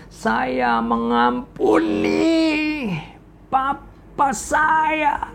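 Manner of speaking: slow, wailing cry, pitch breaking high; a daughter crying out that she forgives her father; crying out forgiveness. Acted. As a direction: sob it out painfully, dragging every word. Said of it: "Dia berteriak".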